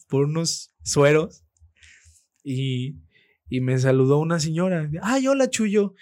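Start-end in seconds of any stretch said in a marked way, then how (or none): 5.0-5.9 high-pitched mock greeting from a gushing lady; excited fan greeting. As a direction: imitate a delighted woman's high hello. Imitates a lady greeting him.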